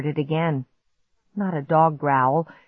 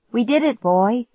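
happy; 6 semitones higher, 4 dB louder than usual. A narrator's reading. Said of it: "I told him".

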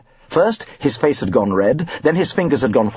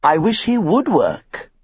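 higher, slow, put-upon teacher voice; lightly voiced carpentry teacher; weary carpentry teacher; 6 semitones higher, slower than usual. A narrator's reading. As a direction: sigh it out like a tired woodwork master. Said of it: "the carpentry teacher had written".

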